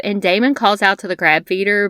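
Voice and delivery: normal talking voice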